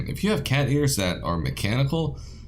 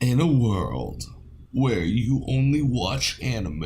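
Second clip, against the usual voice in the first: movie trailer voice; 3 semitones higher than usual. Pretend. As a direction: intone it like an epic film trailer. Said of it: "some kind of movie trailer voice".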